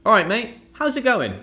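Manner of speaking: high, bright matey hello; chummy greeting. Shown as a demonstration. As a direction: greet a friend cheerfully. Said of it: "You could even say something like".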